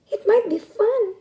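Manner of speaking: high, loud snappy turtle voice; turtle snapping. Acted. A turtle answering the rooster, high and snappy. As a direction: snap it out in a squeaky turtle voice. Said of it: "snapped one of the turtles".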